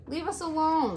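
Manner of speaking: high squeaking plea; squeaky weasel. Acted. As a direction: squeak it out in fear. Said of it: "squeaked Dart".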